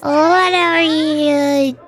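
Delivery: ordinary talk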